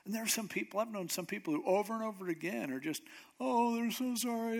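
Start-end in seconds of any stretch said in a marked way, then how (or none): 3.4-4.6 mock sorry. Mocking people who fake sorrow.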